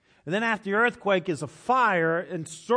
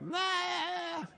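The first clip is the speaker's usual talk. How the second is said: high, drawn-out bleating "nah"; worn-out bleat; 14 semitones higher, 5 dB quieter than usual. Acted. Preacher mimicking someone having one of those days, high bleating groan. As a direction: let out a long, high, wobbly groan of refusal. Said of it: "you're just like".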